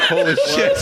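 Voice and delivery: very high-pitched mock amazement; astonished reaction. Fans seeing pit crew leave a limo, astonished. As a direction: squeal it like a stunned onlooker.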